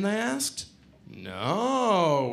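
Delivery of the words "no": long drawn-out, swooping denial; feigned innocence. Acted. Culprits to friends asking about the Dresden, feigned innocence. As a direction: stretch it out, all innocence.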